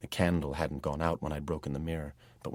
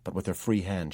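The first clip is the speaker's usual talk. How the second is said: ordinary delivery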